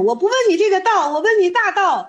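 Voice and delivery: high, loud correction; acted insistence. Student corrects Zhaozhou's answer about the Way, insistent.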